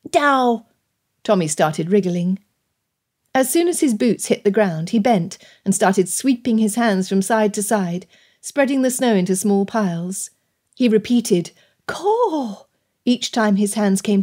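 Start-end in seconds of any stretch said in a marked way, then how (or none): 0.1-0.7 put-on high little-child word; eager toddler. Toddler asking father to be put down, eager. 11.9-12.7 put-on high, drawn-out little-child cooing; excited toddler. Toddler to father about the cold snow, excited. Toddler repeats his word.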